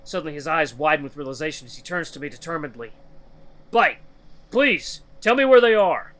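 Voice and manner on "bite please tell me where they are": high, loud, urgent begging; desperate plea. Acted. Loken turning determinedly for help finding children, loud desperate plea. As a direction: plead at the top of the voice.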